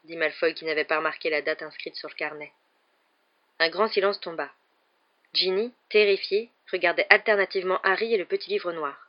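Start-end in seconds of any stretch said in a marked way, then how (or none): none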